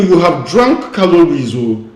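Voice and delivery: usual speaking voice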